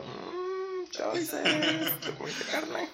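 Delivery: high-pitched teasing mock question. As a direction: act out a squeaky, doubtful reaction with a laugh. Acting out own reaction to quote.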